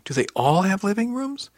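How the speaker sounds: high, incredulous question rising steeply; amazed question. A narrator's reading. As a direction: voice climbs in astonishment. Amazed question about family ships.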